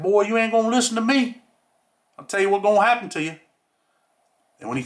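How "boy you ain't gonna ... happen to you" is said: scolding like a country grandfather; old country man. Retold or imitated. Ex-con imitating the old cellmate warning him, country accent. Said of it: "he had that little country accent".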